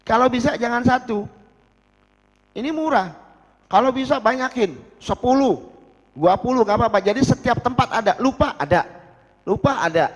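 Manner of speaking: high and loud, pressing the point; teacher urging students to keep siwak everywhere; emphatic urging. Acted. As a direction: push the students to buy many.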